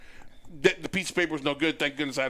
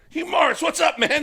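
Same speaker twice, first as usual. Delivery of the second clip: much higher and louder, thrilled to see him; excited greeting; 13 semitones higher, 7 dB louder than usual. Retold or imitated. Cops recognizing Hugh Morris at the stop, thrilled, loud. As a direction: greet a favorite wrestler like a starstruck fan.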